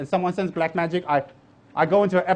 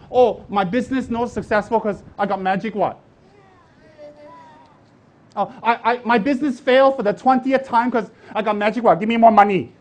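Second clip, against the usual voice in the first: mock complainer; 3 semitones higher, 4 dB louder than usual. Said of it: "if you run around saying".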